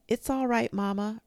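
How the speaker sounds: high, singsong call upstairs; reassuring call to someone upstairs; calling back. A narrator's reading. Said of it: "Rose called back".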